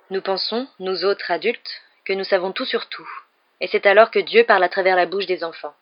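plain reading voice

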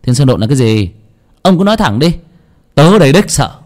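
bristling, daring him to say it; irritated. A narrator's reading. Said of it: "Nó khó chịu". (cáu kỉnh, to và cao giọng)

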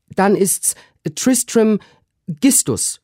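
very high, loud maid's voice; high eager maid; Susanna. A narrator's reading. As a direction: proudly offer the mangled name. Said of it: "sprach Susanna".

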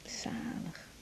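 soft whispered pleasure, drawn out; blissful whisper. Acted. Woman enjoying her lover's massage, blissful whisper. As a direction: breathe it out, content.

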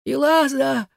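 high, strained cry; last rasping cry. A narrator's reading. Igar calls Elaza a last time, strained high cry. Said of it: "прохрипел Игар в последний раз".

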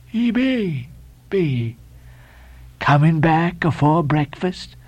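slow, drawn-out cryptic questioning; Elijah's voice. A narrator's reading. Elijah needling the sailors about coming back, slow and odd. Elijah taunts the departing pair.

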